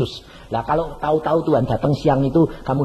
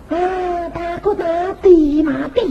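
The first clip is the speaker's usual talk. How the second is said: mock fear; 11 semitones higher, much slower than usual. Mocking fear of death.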